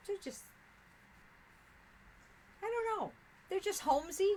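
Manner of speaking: high-pitched delighted gush. Real, not acted. Crafter gushing to chat about cozy towels, squealing high. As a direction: let the voice fly up with delight.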